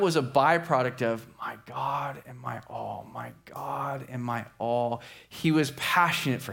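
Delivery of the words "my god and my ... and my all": slow, soft, reverent repetition; hushed prayer. Acted. Preacher echoing Francis's prayer to God, slow and hushed. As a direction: pray it softly and slowly.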